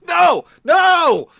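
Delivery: loud high yelling no; shouting no. Acted. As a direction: yell it out, way up high. Yoda quote shouted.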